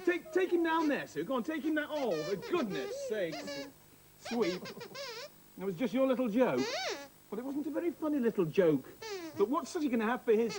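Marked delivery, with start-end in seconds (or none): none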